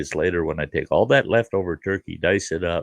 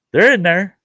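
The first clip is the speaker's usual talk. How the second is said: much higher, louder disbelieving echo; incredulous; 12 semitones higher, 7 dB louder than usual. Retold or imitated. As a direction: repeat it in rising disbelief. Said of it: "I go".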